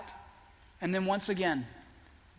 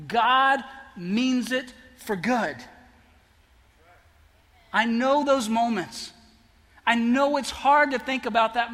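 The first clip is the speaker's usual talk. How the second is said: louder, urgent preacher's push; preaching; 2 semitones higher, 4 dB louder than usual. Acted. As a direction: drive it home to the congregation.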